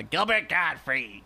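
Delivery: slow, put-on comic character voice; Gilbert Godfrey. Comparing method comedians, drawn-out caricature voice. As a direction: say the name stretched out in character. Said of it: "if he does that character like".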